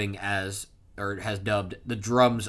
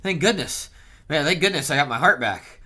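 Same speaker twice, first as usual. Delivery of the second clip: higher-pitched mock relief; acted relief; 4 semitones higher, 5 dB louder than usual. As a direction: play up being hugely relieved. Acting out Summers' relief.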